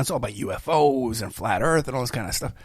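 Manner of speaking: ordinary delivery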